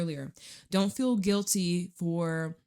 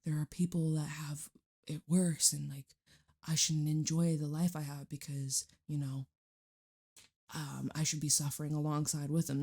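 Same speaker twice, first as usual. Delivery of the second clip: half-whispered mock guilt; soft, mopey imitation of the listener; mock guilty voice; 3 semitones lower, 4 dB quieter than usual. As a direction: mimic a self-pitying inner voice, softly. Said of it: "Be like".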